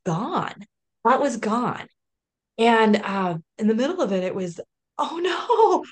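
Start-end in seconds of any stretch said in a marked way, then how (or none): 5.0-5.8 panicked inner voice. Retold or imitated. Retold panic at not reading.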